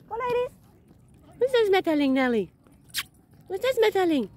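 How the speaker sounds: high cooing voice for dogs. Real, not acted. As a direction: coo at the dogs. High baby talk to dogs.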